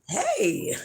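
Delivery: ordinary talk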